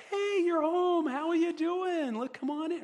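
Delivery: high, bright, loud and chirpy; a casual welcome home that skips over what went wrong; breezy casual greeting. Acted. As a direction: toss off a cheery hello at the door. Shallow breezy welcome, acted.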